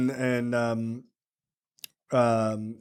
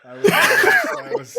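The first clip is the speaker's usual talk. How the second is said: much higher, louder words broken by laughter; real giggling bafflement; laughing disbelief; 4 dB louder than usual. Real, not acted.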